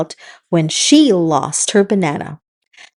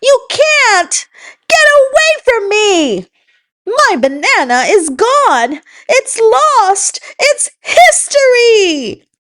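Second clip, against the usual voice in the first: tantrum; 13 semitones higher than usual. Acted. Monkey's tantrum continues.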